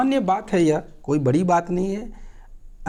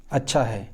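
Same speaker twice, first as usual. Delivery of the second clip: अच्छा है low and falling; a calm falling अच्छा; accepting achchha; 6 semitones lower than usual. Shown as a demonstration. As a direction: settle down on अच्छा है in agreement. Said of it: "स्वीकृति के लिए भी".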